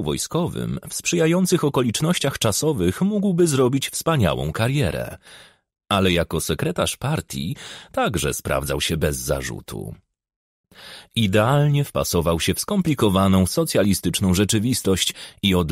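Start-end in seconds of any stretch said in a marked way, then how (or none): none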